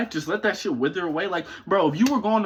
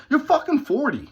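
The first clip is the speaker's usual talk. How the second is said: scornful outburst; 6 semitones higher than usual. Real, not acted. Ranting at a forty-year-old critic, scornful and raised. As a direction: throw it at him like an insult.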